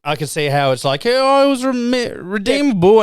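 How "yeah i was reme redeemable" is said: high, slow, sing-song mocking voice; ridiculing the writers with a lofty mock voice; mock smug writers. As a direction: parody them, stretching it out high.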